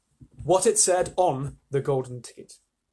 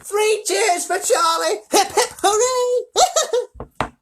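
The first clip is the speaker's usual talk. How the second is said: high, loud, stretched-out jubilation; shouted cheer; 9 dB louder, much slower than usual. A narrator's reading. Grandpa leads three cheers for the boy, shouting. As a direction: lead a full-voiced hurrah. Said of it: "he shouted".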